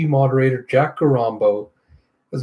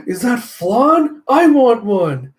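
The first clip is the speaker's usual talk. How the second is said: much higher, slow and louder with delight; excited craving; 9 semitones higher, 5 dB louder, much slower than usual. Acted. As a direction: squeal it out like a kid spotting dessert. Pam excited by flan.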